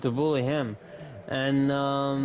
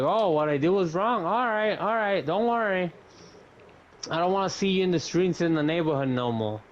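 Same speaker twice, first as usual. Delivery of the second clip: higher, heated retort, fast at the end; retold angry comeback; 6 semitones higher than usual. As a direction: fire it back at her, sharply raised.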